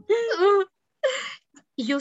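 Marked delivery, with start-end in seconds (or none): 0.1-0.6 a high, loud startled "ah"; startled gasp. Retold or imitated. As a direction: jump at the sudden voice. Startled when mom speaks at night. (sobresalto agudo)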